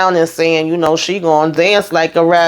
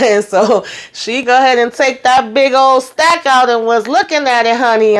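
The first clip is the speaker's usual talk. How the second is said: high, giggly laughing talk; giggling while telling the story; 7 semitones higher than usual. Real, not acted. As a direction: laugh through the words. Laughs retelling the money scene.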